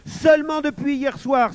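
put-on high, loud boyish interjection; very high, sharp outburst; crying out. A narrator's reading. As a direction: cut in to expose the lie. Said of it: "s'écria Gustave".

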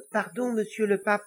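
high, polite little devil. Acted. Little devil interrupting the Pope, high and polite. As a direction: pipe up respectfully as a small devil.

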